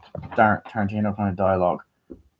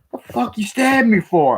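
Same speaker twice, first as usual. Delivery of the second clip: high, loud mock indignation; exaggerated retold outrage; acted outraged protest; 13 semitones higher, 5 dB louder than usual. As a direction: yell it like a wronged fighter. Acted stabbed man protesting.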